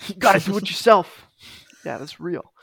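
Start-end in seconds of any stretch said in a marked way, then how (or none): 0.1-1.3 Goggins-style pump-up. Retold or imitated. Goggins voice again.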